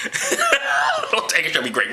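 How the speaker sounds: high, slow, laughing scripture quote; laughing mock reading. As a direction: read the verse slowly through high laughter. Cracking up at the verse reread.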